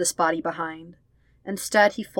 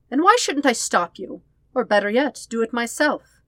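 higher, louder defiant challenge; challenging; 5 semitones higher, 5 dB louder than usual. Acted. Sister challenging her brother's plan, high and defiant. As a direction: dare him to stop you.

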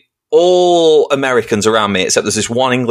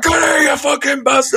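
mock raging dad; 13 semitones higher than usual. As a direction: roar it over the advertising board. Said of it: "this dad's like".

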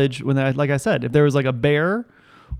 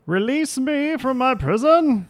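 high, slow trapped-demon moan; growling chained demon; 9 semitones higher, slower than usual. Pretend. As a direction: plead slowly from inside a prison, pitched up. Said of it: "what would it sound like?".

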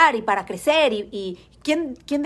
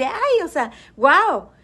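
higher, delighted exclamation; proud wow; 5 semitones higher, 4 dB louder, slower than usual. Retold or imitated. Recalling her pride after the quarantine, excited wow. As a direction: relive the proud little wow.

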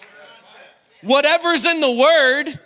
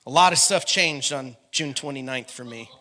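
ordinary delivery

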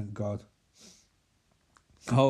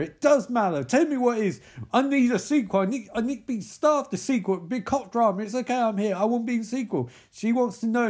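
mock cop drama; 7 semitones higher, 3 dB louder than usual. Mocking the dying-witness scene.

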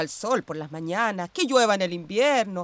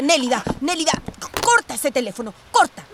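last "corta" far higher and louder; shouted order; 6 semitones higher, 5 dB louder than usual. Acted. Telling Nélida to hang up the phone, shouting. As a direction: yell at her to hang up.

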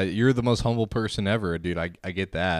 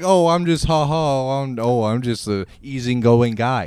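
mocking impression; 2 semitones higher, 6 dB louder than usual. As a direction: parody a fake easygoing guy. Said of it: "pretending like".

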